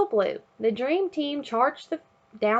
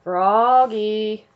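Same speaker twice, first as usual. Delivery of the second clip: calls the name across the house; a father calling his son from afar; 6 dB louder than usual. Acted. Father calling Froggy from outside, loud call. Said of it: "called his father".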